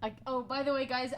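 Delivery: normal talking voice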